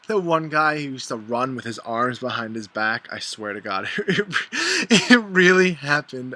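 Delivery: loud, amused, laughter breaking into the words; real laughing. Real, not acted. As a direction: tell it while cracking up at the memory.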